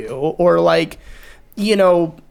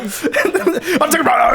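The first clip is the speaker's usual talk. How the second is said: ordinary talk, nothing marked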